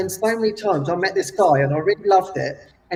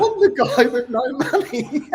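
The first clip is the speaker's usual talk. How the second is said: laughing; 6 semitones higher, 4 dB louder than usual. Retold or imitated. Retelling funny reunion, laughing.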